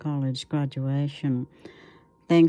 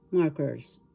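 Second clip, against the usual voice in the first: normal talking voice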